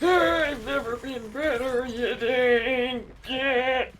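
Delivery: high voice, slow on the insult; character voice. Retold or imitated. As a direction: drag out the insult. Repeated line, slowed ending.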